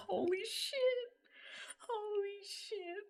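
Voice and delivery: very high, stunned gasp of holy shit; shocked exclamation. Real, not acted. Streamer stunned by a friend's news, high shocked voice. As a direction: react in disbelief.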